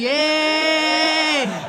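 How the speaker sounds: long, held-out yeah; mock an old man's drawn-out yeah. Older man agreeing for no reason, drawn out. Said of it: "Older men say yeah for no reason".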